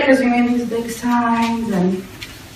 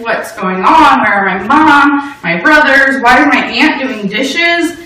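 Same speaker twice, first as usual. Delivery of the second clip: louder, pressing panicked questions; a scared teen's rising panic; frantic questions; 3 semitones higher, 6 dB louder than usual. Retold or imitated. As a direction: demand answers from dad, alarmed. Panicked teen questioning crying dad.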